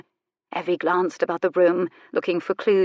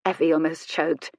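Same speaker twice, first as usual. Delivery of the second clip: usual speaking voice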